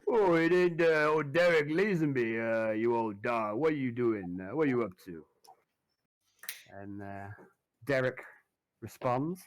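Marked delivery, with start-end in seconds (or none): 0.1-5.2 put-on loud, cheery swamp boatman hailing an old friend; jovial backwater boatman, louder; Gumbo, hearty greeting. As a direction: boom out a greeting to an old rival. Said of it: "and he goes".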